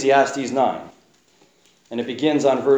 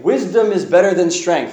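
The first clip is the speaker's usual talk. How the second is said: louder ringing proclaim; hammed-up victorious ending line, higher; triumphant proclamation; 5 semitones higher, 6 dB louder than usual. Shown as a demonstration. Preacher playing the expected happy ending, triumphant. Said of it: "would be a great way to end".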